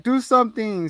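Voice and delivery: high-pitched retold demand; mock urgent plea. Retelling people unable to restrain David, mock urgent plea. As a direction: plead it, pitched well up.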